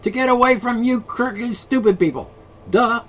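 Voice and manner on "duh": duh. Pretend. Mocking people.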